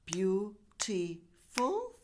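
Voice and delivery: beautiful drawn out very slowly, syllable by syllable; slow syllable demo. Shown as a demonstration. Teacher counts out syllables for students, slowly. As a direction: stretch beautiful out so each beat is heard. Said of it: "three syllables".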